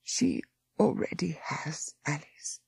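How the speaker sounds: soft, slow, unvoiced whisper. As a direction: breathe it like a last secret. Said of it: "she whispered".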